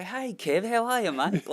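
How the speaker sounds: high, bright-pitched sing-song "Hey, Kev"; cheeky mock-friendly greeting. Prankster calling at night, greeting the angry bloke cheekily. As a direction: play it like a cheeky prank caller baiting him.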